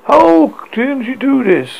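high, slow anguished cry. A narrator's reading. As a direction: noble crying out in dismay. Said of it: "cried one of the nobles".

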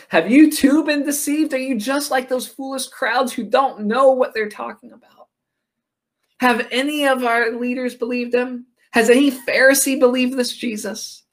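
high-pitched, incredulous rebuke acted out; imitating outraged religious leaders; indignant Pharisees. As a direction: question them in a high, scornful voice. Pharisees scornfully rebuke the guards.

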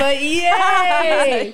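high, loud burst of glee; excited cheer. Real, not acted. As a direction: cheer like you just won. Cheering the topic.

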